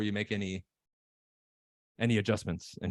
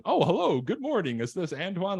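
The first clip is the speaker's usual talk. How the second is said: sing-song polite sales greeting, higher; typical salesperson; 6 semitones higher than usual. Shown as a demonstration. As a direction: sound like a scripted cold caller. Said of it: "different than the typical salesperson".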